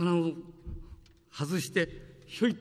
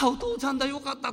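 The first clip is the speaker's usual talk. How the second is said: put-on relieved child's cry; 10 semitones higher, 3 dB louder than usual. Child relieved seeing father.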